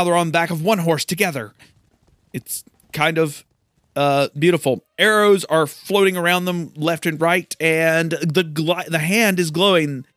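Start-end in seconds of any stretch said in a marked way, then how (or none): none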